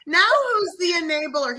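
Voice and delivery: high, loud mock taunt; sarcastic teasing. Wife teases husband who caved, sarcastically high and loud. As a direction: gloat at him playfully. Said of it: "And I was like".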